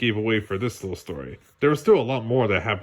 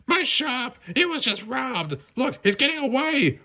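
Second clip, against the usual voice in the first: high, far-off put-on voice calling for help; merchant shouting; 13 semitones higher than usual. Shopkeeper yelling for help after a robbery, high put-on voice. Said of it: "a local merchant shouted".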